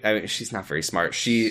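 usual speaking voice

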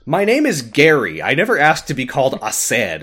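loud mock protest; hammed-up grievance, punched out loudly; aggrieved Aced. Man in bear mask objects to his name, mock-aggrieved.

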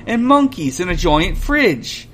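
lightly voiced young fox, whiny; a touch of a grumbling kid; light complaining fox voice. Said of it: "complains the fox".

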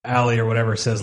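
normal talking voice

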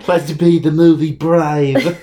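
loud, drawn-out mock British accent. Friend riffs on the kids' brave line, mock British accent. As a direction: echo the bad British accent, slow and loud. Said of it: "terrible British accents".

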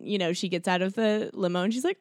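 ordinary talk, nothing marked

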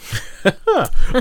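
bright burst of laughter; laughing. Real, not acted. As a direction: laugh out loud, genuinely amused. Laughs at friend's remark.

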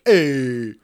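mock New York "hey"; New York accent. Shown as a demonstration. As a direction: greet like a caricature New Yorker. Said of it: "This is my New York".

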